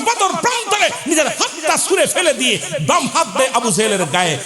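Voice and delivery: high, impassioned cries at the climax; full-force preaching. Acted. Preacher tells of the boy tearing off his hand, high fervent preaching. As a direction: drive the battle tale to its peak.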